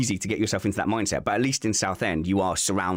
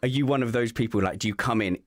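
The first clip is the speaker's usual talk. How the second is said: ordinary talk, nothing marked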